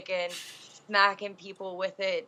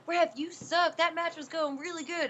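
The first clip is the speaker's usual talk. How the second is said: mock yelling; 8 semitones higher than usual. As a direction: imitate a wrestler griping at the ref. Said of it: "yelling at the ref".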